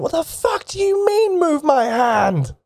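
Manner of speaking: high, incredulous squeal of disbelief; a prisoner shrieking in shocked disbelief; outraged prisoner. Acted. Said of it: "He's just looking at you going".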